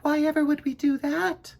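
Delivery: high, small puzzled voice; tiny fairy puzzled by the bee's fear. A narrator's reading. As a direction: sound sweetly baffled. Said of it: "came a tiny voice".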